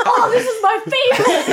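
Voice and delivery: high-pitched, loud mock rapture; imitated delight. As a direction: gush like a husband who loves it, laughing. Retelling husband's delight again.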